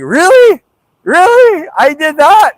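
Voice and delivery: hammed-up astonished reaction; imitated disbelief. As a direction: squeal it up in mock amazement. Retold disbelief of Penhall.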